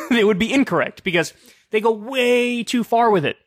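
chuckling through the words; laughing. Real, not acted. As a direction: laugh it out as you go. Laughing at his own joke.

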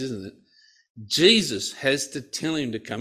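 ordinary talk, nothing marked

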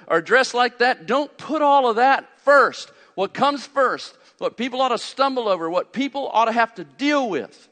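loud, high, driving sermon voice; preaching Paul's charge. Retold or imitated. Pastor voicing Paul to the church, fired-up preaching. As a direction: hammer each don't home. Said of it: "he says".

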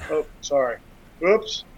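ordinary talk

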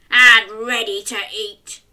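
loud, hungry troll voice; troll roar. Acted. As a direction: bellow like a greedy troll. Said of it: "he roared".

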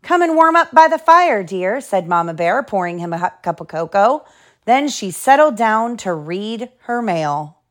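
lively storybook reading; reading to children. Acted. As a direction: read it like bedtime story. Reads picture book aloud.

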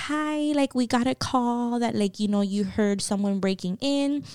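soft, polite voice of an imitated officer; lady cop. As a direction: voice a cop gently checking in.

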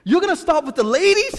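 hammed-up shock at the news; incredulous friend. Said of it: "He couldn't believe that one".

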